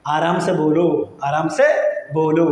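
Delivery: "ആരാം സെ ബോലോ" measured and drawn out; slow, careful "Aram സെ ബോലോ"; slow teaching demo. Shown as a demonstration. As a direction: say "Aram സെ ബോലോ" slowly for learners to copy. Teacher slows it for learners.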